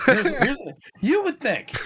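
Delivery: says it through a real laugh. Real, not acted. Teasing co-host about bingo, laughing.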